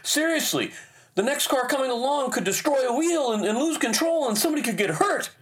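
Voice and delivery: retold high, urgent warning; insistent wife. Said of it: "she continued, even louder".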